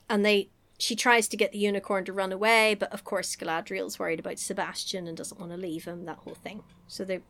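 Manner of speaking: usual speaking voice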